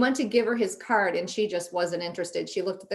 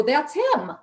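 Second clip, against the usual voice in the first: excited; 8 semitones higher, 4 dB louder than usual. Retold or imitated. Spotting him at the gym.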